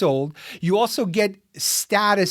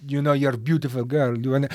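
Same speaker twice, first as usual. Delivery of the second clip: put-on French accent; 5 semitones lower, faster than usual. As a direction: lay on a seductive French accent. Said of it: "no, that was a French accent".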